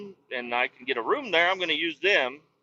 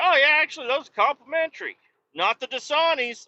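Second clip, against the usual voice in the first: higher chirpy clerk voice; lightly voiced chipper clerk; mock clerk; 9 semitones higher than usual. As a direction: lift it up like a cheerful counter guy. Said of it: "He's like".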